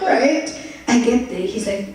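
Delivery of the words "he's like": normal talking voice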